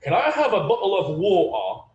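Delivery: Cockney "bottle of water" with glottal stops for the T's; Cockney accent. Shown as a demonstration. Teacher demonstrating Cockney to the class, glottal stops. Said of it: "they don't pronounce the T sometimes".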